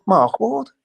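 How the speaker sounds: high, loud, rising with cheek; a cocky one-liner, tongue in cheek; cheeky retort. Retold or imitated. Player cheekily tells the manager his name. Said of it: "I said".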